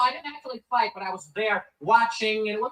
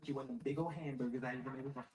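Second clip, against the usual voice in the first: deep ad voice; 11 semitones lower, 13 dB quieter than usual. Acted. Ad voice about hamburgers.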